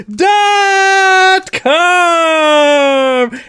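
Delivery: high, loud yell; shout. Real, not acted. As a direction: belt it out. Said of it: "I didn't tell you to shout".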